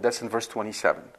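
normal talking voice

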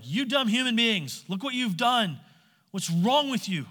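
loud, high, accusing shout; mock angry bellow. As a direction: bellow at them like an angry judge. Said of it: "bellow out accusation and proclamation".

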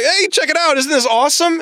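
put-on a proud boy showing his new toy; proud excitement. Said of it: "And so he's like".

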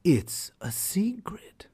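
soft secretive whisper. Acted. Hare whispers to the tiger about the secret way. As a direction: breathe it like a secret.

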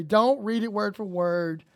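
usual speaking voice

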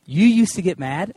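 high, incredulous double take; can't believe what he's hearing; disbelief. Retold or imitated. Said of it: "And I was like".

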